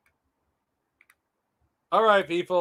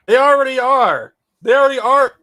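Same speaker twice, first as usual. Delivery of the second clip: loud; 5 semitones higher, 10 dB louder than usual. Real, not acted. Ranting about InfoWars fans.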